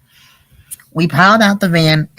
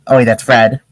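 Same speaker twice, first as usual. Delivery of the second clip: usual speaking voice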